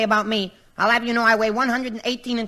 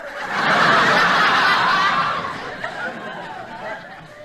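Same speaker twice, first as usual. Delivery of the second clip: long high cackle. Real, not acted. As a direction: crack up in a high shrieking laugh. [name] cracks up at joke.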